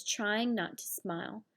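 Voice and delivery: ordinary delivery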